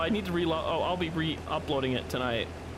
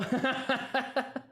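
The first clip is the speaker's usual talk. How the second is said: high burst of laughter; 7 semitones higher than usual. Real, not acted. Laughing at link.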